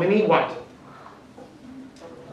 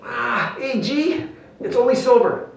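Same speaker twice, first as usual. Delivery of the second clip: higher-pitched voice of a let-down thief; disappointed thief; 6 semitones higher than usual. Acted. Thief looks at stolen chain, acted high-pitched letdown. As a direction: play the thief spotting worthless loot. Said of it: "he looks at it, you know, and he goes".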